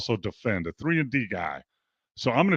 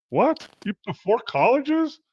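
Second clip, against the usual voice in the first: incredulous disbelief; 10 semitones higher than usual. Real, not acted. Astonished at player's four schools.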